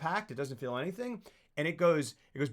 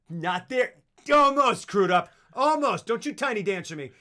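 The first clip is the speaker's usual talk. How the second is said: mock defiant taunt; 5 semitones higher, 7 dB louder than usual. Retold defiance at the tearjerker.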